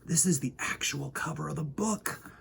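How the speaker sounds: hushed whisper to the audience. Real, not acted. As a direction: lean in and whisper it like a secret. Showing the book cover.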